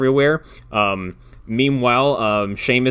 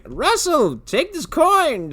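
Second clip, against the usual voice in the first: high, slow wizard cry; old wizard calling; much slower than usual. Retold or imitated. Grandpa hands Russell the coin, high drawn-out wizard call. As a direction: stretch it out like a creepy old wizard. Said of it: "is like".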